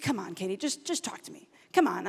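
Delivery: low, hushed pleading; gentle coaxing. Retold or imitated. Dad asking his sulking daughter to talk, softly coaxing.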